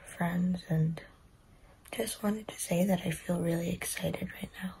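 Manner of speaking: whispering quietly at night; soft unvoiced late-night whisper. Real, not acted.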